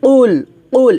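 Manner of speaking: "কুল" com Q encorpado do fundo da boca; Q espesso. Shown as a demonstration. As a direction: engrosse o som inicial de "কুল", como qaf. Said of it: "মোটা করে উচ্চারণ করতে হবে".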